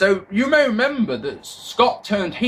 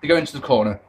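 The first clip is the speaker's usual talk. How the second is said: ordinary talk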